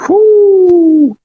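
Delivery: high, loud joyful whoop. Real, not acted. Host cheering after the caller hangs up, loud whoop. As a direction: let out a big celebratory whoo.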